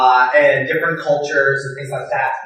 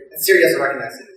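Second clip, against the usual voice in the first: ordinary talk, nothing marked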